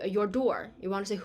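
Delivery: ordinary delivery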